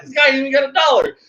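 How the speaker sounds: high-pitched jeering shout; mock public heckling. Another beggar joins in shaming him, mock shouted heckle. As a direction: imitate a heckler calling across the street. Second homeless man joins the mockery.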